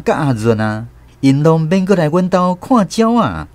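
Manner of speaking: usual speaking voice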